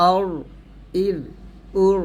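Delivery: অর and উর with thick R, ইর with thin R; heavy R in অর, light in ইর, heavy in উর. Shown as a demonstration. Teacher sums up অর, ইর, উর, heavy and thin Ra. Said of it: "জবর পেশ থাকলে মোটা".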